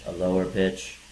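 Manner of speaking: low, level monotone. Shown as a demonstration. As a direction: drop to one steady low note for every word.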